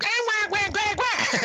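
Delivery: high mock crying wah wah; mock wailing. Reviewer mocking the little girl in the episode, high mock wailing. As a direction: whine like an annoying little girl. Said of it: "the girl was just like".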